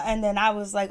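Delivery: ordinary talk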